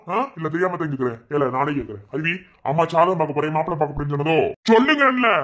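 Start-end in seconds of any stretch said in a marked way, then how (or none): 4.7-5.3 loud, high angry demand; furious shout. Acted. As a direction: bark the order at them. Man demands an answer, furious. (கோபமான கத்தல்)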